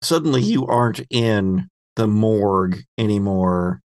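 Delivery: ordinary talk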